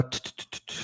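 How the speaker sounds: ordinary talk, nothing marked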